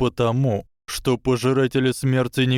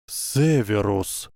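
cracked voice; slower than usual. A narrator's reading. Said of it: "произнёс надтреснутым голосом директор".

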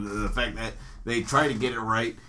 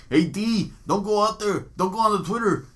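higher-pitched mock warning; imitated warning; 8 semitones higher than usual. Imagined camp warning Nate Diaz off Twitter, high mock voice. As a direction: act out a pleading heads-up, pitched up. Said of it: "He's gonna be like".

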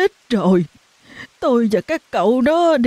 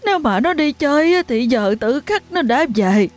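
distraught mother; 7 semitones higher than usual. A narrator's reading. Mother pleading with neighbour about missing daughter, distraught. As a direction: beg for help, voice breaking.

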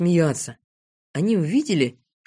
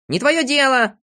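high, raised-voice retort; heated, pitched-up rebuff; angry shout; 8 semitones higher, 4 dB louder than usual. A narrator's reading. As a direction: shout back in a quarrel. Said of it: "кричал Авоська".

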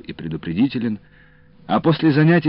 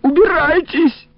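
high, loud, drawn-out shriek; 5 dB louder, slower than usual. A narrator's reading. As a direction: squeal the order at full pitch. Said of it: "завизжала Бабале". (громкий протяжный визг)

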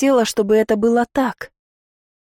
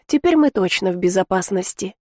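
usual speaking voice

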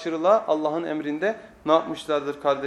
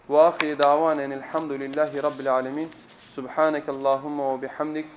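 closing prayer; 6 semitones lower, 4 dB quieter than usual. Acted. Closing Arabic prayer formula.

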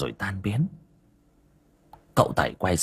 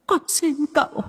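put-on very high, wailing old woman's cry; wailing ghost woman. Old woman's ghost wails to the master for help, very high and crying. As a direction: sob and beg like a tormented ghost. Ghost begs at Tẩy's feet.

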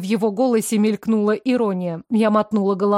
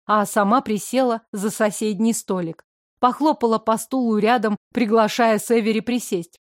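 usual speaking voice

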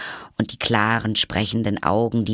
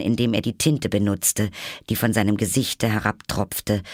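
usual speaking voice

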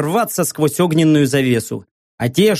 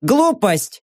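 high metallic outburst; clanging emotional voice; 4 semitones higher than usual. A narrator's reading. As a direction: snap it out like a machine. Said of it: "эмоционально лязгнула система".

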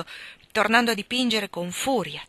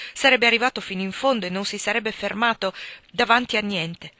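usual speaking voice

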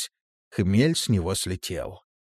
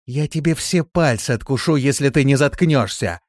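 angry threat; 6 semitones higher, 5 dB louder than usual. Acted. Threat to silence screaming man.

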